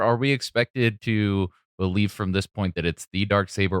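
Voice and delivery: ordinary delivery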